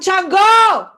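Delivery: high, loud ringing call; shouted call. Real, not acted. Chanting to Chango, a high loud call. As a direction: call his name out to the sky. Calling out to the orisha.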